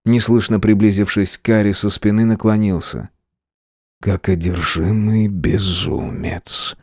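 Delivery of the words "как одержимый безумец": hot whisper into her ear; whispering a secret. Acted. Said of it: "горячо прошептал на ухо".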